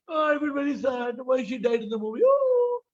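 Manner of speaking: hammed-up tearful moviegoer; high, put-on lament; mock crying. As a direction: wail in imitation of a weeping film fan. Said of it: "why you cry?".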